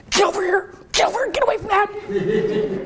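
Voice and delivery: high hushed urgent whisper shout; whisper yelling. Retold or imitated. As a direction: hiss it urgently at a wandering kid. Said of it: "I started to whisper shout at him".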